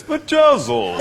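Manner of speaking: Vajazzle said very high and drawn-out, plummy; mimicking her posh way of saying Vajazzle; posh mimicry. Teammate mocks her posh Vajazzle, high and plummy. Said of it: "No, you talk like this".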